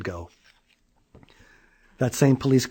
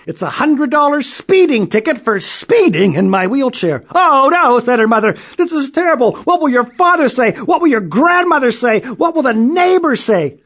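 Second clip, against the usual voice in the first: high, loud wailing mother; panicked mother; 12 semitones higher, 9 dB louder than usual. A narrator's reading. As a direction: fret dramatically about everyone. Child confesses, mother panics.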